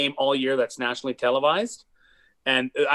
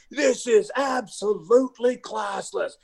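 high, slow mock play-by-play indignation; outraged announcer; 7 semitones higher than usual. Imitating the announcer raging about a player on national TV, high and slow. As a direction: ham up a scandalized TV announcer. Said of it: "the announcer's going crazy".

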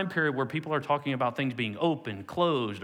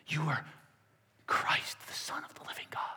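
hushed whisper; 8 dB quieter than usual. Shown as a demonstration. Preacher repeating Peter's confession as a soft whisper. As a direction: whisper it softly. Quiet retake of Peter's words.

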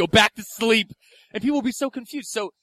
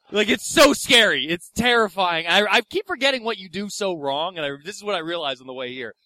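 normal talking voice

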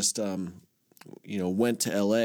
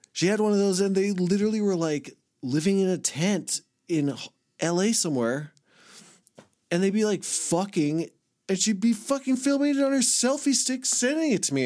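worked-up retelling; 10 semitones higher than usual. Real, not acted. Worked up retelling tent videos.

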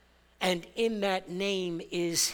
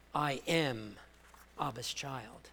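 quiet declaration; 4 semitones lower, 6 dB quieter than usual. Shown as a demonstration.

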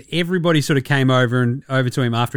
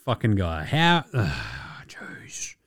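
low, softer muttered fury; fuming under breath; 7 semitones lower, 7 dB quieter than usual. Retold or imitated. Imagined teammate muttering in quiet fury at the player. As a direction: seethe it quietly to yourself. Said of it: "you've gotta be like".

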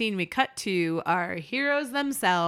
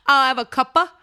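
high, loud mock British order; 5 semitones higher, 8 dB louder than usual. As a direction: ask for a cuppa in a fake British voice. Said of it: "she gave herself away by saying".

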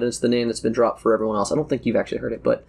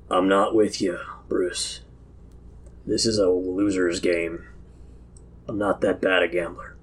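low, subdued character voice; wary gambler backing out; 6 semitones lower, 4 dB quieter than usual. Acted. Mullion disowns Bruce before the guards, low and wary. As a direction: disown him quietly and low.

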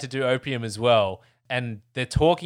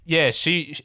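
normal talking voice

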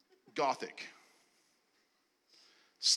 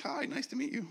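much higher, lightly mocking hello; mock sweet greeting; 12 semitones higher, 4 dB quieter than usual. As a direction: play the chirpy polite stranger.

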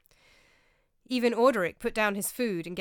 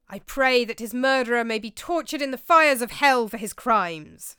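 loud pious declaration, pitched up; 5 semitones higher, 12 dB louder than usual. A narrator's reading. Brother Roger at the table, praying loudly and piously. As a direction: Roger raising his voice in solemn piety. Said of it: "said Brother Roger piously".

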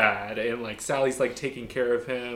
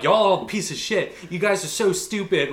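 higher-pitched retold angry rant; mock telling-off; 6 semitones higher than usual. He tells off the Nazis handing out flyers, mock angry. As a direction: act out scolding them, half in earnest. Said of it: "tells them off and stuff".

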